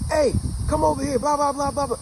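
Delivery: imitated yell from the back door; retold yell. Father yelling at his son from the back door, retold yell. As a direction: call out high and loud, trailing into blahs. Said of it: "somebody yell at him".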